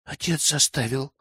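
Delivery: put-on soft, lightly hushed girl's excuse; hushed confession. A narrator's reading. Girl blames her father to village elder, hushed. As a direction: say it under your voice, gently voiced as a girl. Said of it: "прошептала она".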